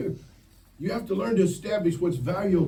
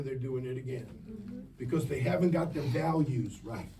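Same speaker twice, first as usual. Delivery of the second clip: ordinary delivery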